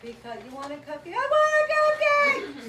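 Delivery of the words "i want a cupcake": put-on high, loud crying scream of a kid; child's tantrum wail. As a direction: wail like a toddler denied a treat. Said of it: "the kid in the video was screaming".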